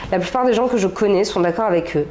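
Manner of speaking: ordinary talk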